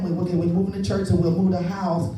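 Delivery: ordinary talk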